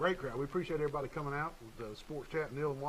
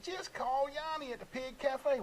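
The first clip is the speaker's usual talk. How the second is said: higher, drawn-out pitch; upbeat sponsor plug; 9 semitones higher, slower than usual. Real, not acted. Host solving listeners' holiday squabbles with a plug, upbeat and high. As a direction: offer the easy answer, slowly and high.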